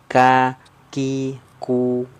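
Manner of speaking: ka ki ku said one by one with the tongue a bit forward; light kaf syllables ka, ki, ku; kaf syllable drill. Shown as a demonstration. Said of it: "menyentuhnya ke langit-langit lunak dan keras". (latihan suku kata ka ki ku, lidah sedikit ke depan)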